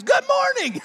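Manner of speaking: very high, booming hello; excited greeting. Retold or imitated. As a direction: imitate an overeager store worker greeting a customer. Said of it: "they were excited about what they were doing".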